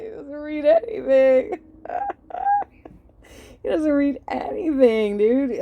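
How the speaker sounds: gleeful loud jab at the host; excited laughing rant. Real, not acted. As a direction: crow it with glee. Ranting that host never reads.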